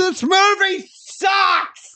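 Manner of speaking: high, slow, drawn-out outburst; exasperated yell. Real, not acted. Host fed up reviewing the movie, exasperated shout. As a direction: cry it out in fed-up frustration. Exasperated verdict after rant.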